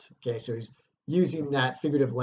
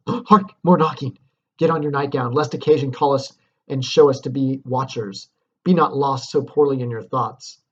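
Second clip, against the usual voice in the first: urgent Lady Macbeth; 8 dB louder than usual. Acted. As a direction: rush him before they are caught. Dramatic reading of Lady Macbeth.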